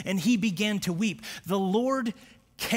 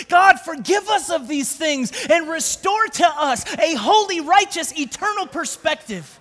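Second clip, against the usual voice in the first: higher and louder, a pleading shouted prayer; impassioned prayer; 8 semitones higher, 6 dB louder than usual. Acted. Preaching prayer building to a shout. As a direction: pastor praying for the congregation, crying out loudly. Prays aloud at full force.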